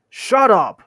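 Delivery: high, loud troll shout; loud, angry outburst; quarrelsome shout. Acted. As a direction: shout them down. Two trolls shout down the third.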